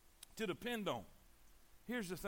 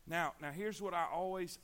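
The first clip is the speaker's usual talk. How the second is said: ordinary talk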